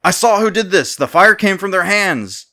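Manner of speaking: finally bellowing it so the king hears; yelling. A narrator's reading. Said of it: "Now yelling at this point".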